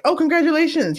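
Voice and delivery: high, airy mock congratulations. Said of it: "I bet you he's just like".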